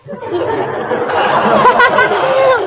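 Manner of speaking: put-on high girlish giggle. [name] laughs.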